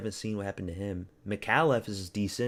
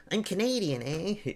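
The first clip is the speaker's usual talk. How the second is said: much higher hammed-up Canadian accent with a bouncy eh; mock Canadian accent; 9 semitones higher than usual. Streamer joking with Canadians in chat, hammed-up Canadian accent. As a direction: play a cartoon Canadian, eh.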